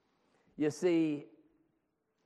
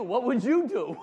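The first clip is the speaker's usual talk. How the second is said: "What would you do?" asked louder and higher with a chuckle; laughing question; 10 semitones higher, 11 dB louder than usual. Shown as a demonstration. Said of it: "You're hearing the same words, right?".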